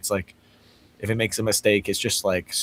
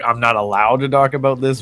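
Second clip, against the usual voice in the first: mock canned refusal, louder; evasive chatbot; 4 semitones higher, 6 dB louder than usual. Chatbot dodging a question, mock canned refusal. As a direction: recite a stock refusal. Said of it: "it might just be like".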